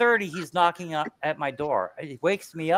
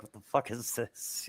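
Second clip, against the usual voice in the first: slow, much softer incredulous aside; disbelief; 3 semitones lower, 11 dB quieter than usual. Retold or imitated. Woken by the neighbour pounding the door, soft and incredulous. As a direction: ask it under your breath, baffled. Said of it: "It's like".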